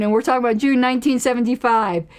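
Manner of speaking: ordinary delivery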